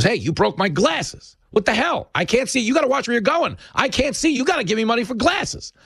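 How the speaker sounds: high-pitched, outraged street scammer act; indignant hustler. Acted. Acted street hustler accosting tourists.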